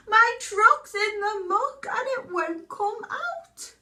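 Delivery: high, loud call across the water; shouting for help. Acted. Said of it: "hears them shout".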